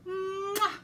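high smacking kiss; kiss sound. Acted. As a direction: blow a big loud kiss. Acting out a kiss.

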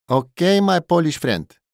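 high, booming cheer; loud and joyful. A narrator's reading. Thai barman to his Polish customer, loud and joyful. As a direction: sing out merrily to a pal. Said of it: "Taj znowu był głośny i radosny".

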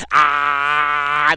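loud mock scream of ah; hammed-up scream impression; imitated scream. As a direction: belt out a short imitated scream. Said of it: "that's pretty much how he says".

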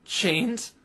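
can't believe what he hears; stunned disbelief. Acted. Phoenix to Twilight on seeing the locks, stunned.

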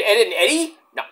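ordinary talk, nothing marked

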